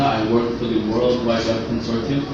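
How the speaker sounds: normal talking voice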